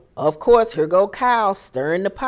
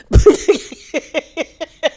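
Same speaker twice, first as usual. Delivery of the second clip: real laugh; 10 semitones higher, 6 dB louder than usual. Real, not acted. Laughing at her impression.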